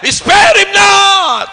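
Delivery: high, slow, stretched cry; shouted preaching. Real, not acted. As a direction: hurl the command out at full force. Preacher shouting scripture at the uninterested.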